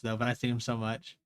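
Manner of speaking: ordinary talk, nothing marked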